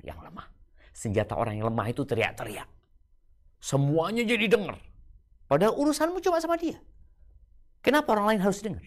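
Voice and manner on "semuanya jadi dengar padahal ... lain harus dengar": forceful preaching. Real, not acted. Preacher rails against public yelling.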